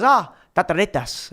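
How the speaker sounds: high-onset attempt at Icelandic; imitated Icelandic phrase. Icelandic teen answering with his motto, imitated Icelandic. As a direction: imitate the kid's Icelandic saying. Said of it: "I can't say it perfect".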